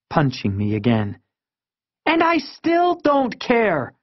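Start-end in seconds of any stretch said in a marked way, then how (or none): 2.1-3.9 high, stretched-out exclamation; defiant cry. A narrator's reading. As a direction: drag it out high, refusing to listen. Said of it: "she cried".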